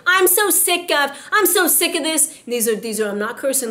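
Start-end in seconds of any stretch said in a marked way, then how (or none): 0.1-2.4 higher mock fed-up moan; fed-up complaint. Preacher imitating people who complain all day, mock moan. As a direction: imitate someone who is sick of everything. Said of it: "You ever heard people say".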